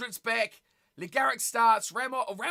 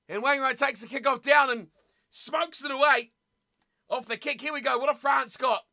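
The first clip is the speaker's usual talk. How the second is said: ordinary talk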